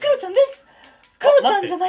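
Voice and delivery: squeaky falsetto catchphrase; very high, childlike put-on voice; Kuro-chan. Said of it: "常にクロちゃんで行く". (甲高い裏声のモノマネ)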